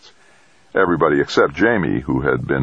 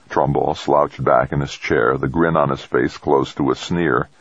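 ordinary delivery

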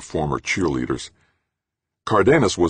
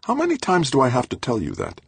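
high, tired exasperation; exasperated; 11 semitones higher than usual. A narrator's reading. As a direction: sigh it out, fed up. Exasperated scientist.

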